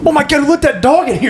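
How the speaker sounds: high-pitched excited exclamation; amused outburst. Real, not acted. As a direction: blurt it out high, startled by the dog. Dog interrupts the recording, both crack up.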